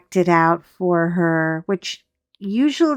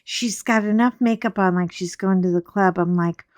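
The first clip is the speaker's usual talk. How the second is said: ordinary talk, nothing marked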